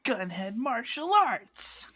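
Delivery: slow, high move announcement; announcing. Retold or imitated. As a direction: call it out like a move name. Quoting a named move.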